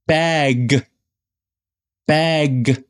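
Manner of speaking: "bag" with the final g fully voiced; clear ending. Shown as a demonstration. Teacher giving second example to learners, voiced g in "bag". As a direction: release the g at the end of "bag". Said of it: "ending consonants are dropped".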